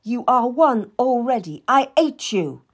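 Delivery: high and loud, hitting hate; shouted hatred. Acted. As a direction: spit out the final insult. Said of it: "Anne shouted".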